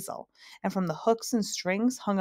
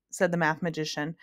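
usual speaking voice

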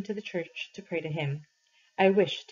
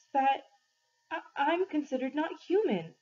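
higher, stumbling over the words in fear; a frightened boy losing his footing mid-sentence; faltering voice; 8 semitones higher than usual. Acted. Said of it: "my voice faltering".

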